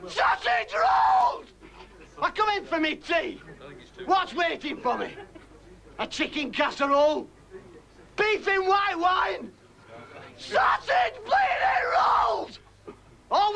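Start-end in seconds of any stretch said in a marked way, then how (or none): 0.1-1.5 very high, drawn-out bellow; outraged shout. Acted. Outraged punchline about dinner. 10.4-12.6 high, slow exasperated cry; outraged shout. Acted. Outraged punchline about dinner.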